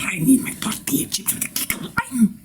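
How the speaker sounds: high-pitched, insistent bratty demand; increasingly incomprehensible brat. Acted. Insisting to the cashier it doesn't matter, high bratty whine. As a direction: whine it high, as a tantrum starts. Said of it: "I get progressively incomprehensible".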